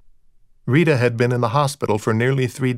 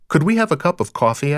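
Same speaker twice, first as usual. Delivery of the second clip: higher, awkward sudden request; blurted out; 5 semitones higher than usual. A narrator's reading. Dexter escapes his wife's questions, blurting. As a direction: blurt it to change the subject. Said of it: "I blurted out the only words".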